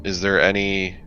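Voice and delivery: ordinary delivery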